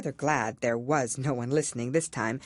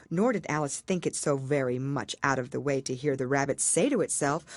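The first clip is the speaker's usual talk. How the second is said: plain reading voice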